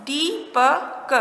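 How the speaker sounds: दीपक very slowly, broken into दी, प, क; syllable by syllable. Shown as a demonstration. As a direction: draw out Deepak sound by sound for learners. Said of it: "क्या शब्द बना?". (दीपक को तोड़कर, बहुत धीरे)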